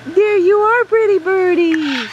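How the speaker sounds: high, stretched-out cooing to a bird; cooing pet talk. Real, not acted. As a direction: gush sweetly at a pet bird, drawing out the words.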